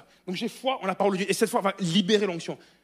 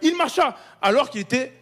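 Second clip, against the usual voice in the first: full-force preaching; 6 semitones higher, 8 dB louder than usual. Acted. Preacher to congregation about Paul, loud fervent preaching. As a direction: proclaim it to the back row.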